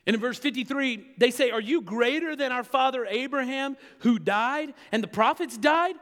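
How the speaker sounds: preaching at full force. Acted. Preacher driving home God's promise, loud and high. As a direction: build the sermon to a ringing peak.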